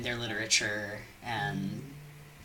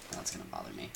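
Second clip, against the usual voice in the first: whispered aside; 13 dB quieter, much faster than usual. Real, not acted. Annoyed aside to himself.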